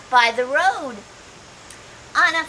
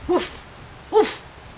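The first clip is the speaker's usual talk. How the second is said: mock woof, higher; dog bark; 7 semitones higher than usual. A narrator's reading. Reading to kids, playful dog woof.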